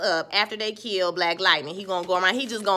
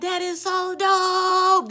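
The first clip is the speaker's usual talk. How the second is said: slow, high, drawn-out admiration; dreamy slow wow; awed gush; 7 semitones higher, much slower than usual. Retold or imitated. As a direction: stretch it out, amazed by the shot. Awed by the special effects.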